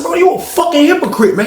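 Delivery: high-pitched indignant accusation; acted angry outburst, pitched high. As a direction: throw the accusation out with voice way up. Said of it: "Man, lower your voice".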